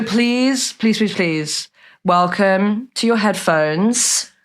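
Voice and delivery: slow build to a drawn-out, high name; show announcer welcome. Real, not acted. Podcast host welcomes the guest to listeners, drawn-out announcer call. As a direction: announce the star, stretching the name long.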